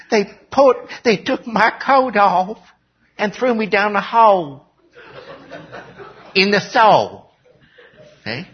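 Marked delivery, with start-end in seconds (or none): 0.1-7.3 high, slow Southern drawl; mock-tragic Southern complaint, pitched up; Southern accent. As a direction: wail the grievance in hammed-up Southern. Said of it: "all of a sudden turned Southern".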